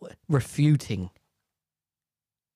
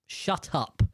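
normal talking voice

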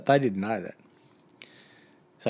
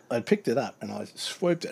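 ordinary talk

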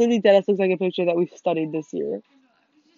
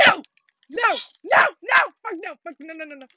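panicked no; 7 semitones higher than usual. Real, not acted. Dodging an attack in game.

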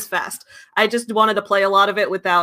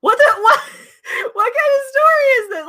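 high, loud laugh of disbelief; laughing as she can't believe it; incredulous laughing; 3 dB louder than usual. Real, not acted. Disbelief at the story.

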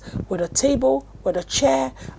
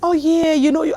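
higher-pitched, drawn-out Canadian hello; put-on Canadian accent; 5 semitones higher than usual. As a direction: imitate a sweet Canadian phone voice. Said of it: "my voice is different".

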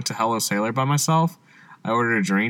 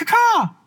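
very high cawing bird call; chirping bird person; 3 dB louder than usual. Retold or imitated. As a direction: caw it like a bird. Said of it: "'cause it sounds like a bird".

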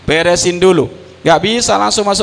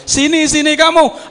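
calling out; 10 semitones higher than usual. Retold or imitated. Allah calls the faithful.

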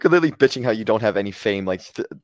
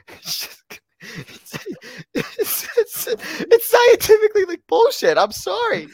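high, breaking voice through helpless laughter; laughing; 6 dB louder than usual. Real, not acted. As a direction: laugh so hard the words squeak out. Laughing too hard to talk.

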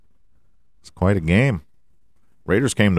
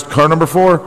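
announcer hype; 3 dB louder than usual. Pretend. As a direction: build up and blast out the winner's name. Announcer intro of winner.

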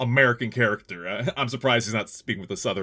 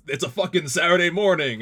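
higher-pitched mock shout; retold shout; 6 semitones higher, 4 dB louder than usual. Donald complaining about Saturday morning, mildly shouted imitation. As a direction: lightly imitate a man shouting in protest. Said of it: "Donald shouts".

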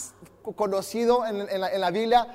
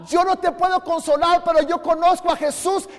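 ordinary talk

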